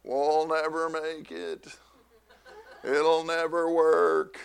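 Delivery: slow, gloomy droning Glum; Glum impression. Pastor imitates cartoon Glum to the laughing congregation, slow and gloomy. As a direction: drag it out like a hopeless cartoon pessimist. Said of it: "there was this guy, Glum".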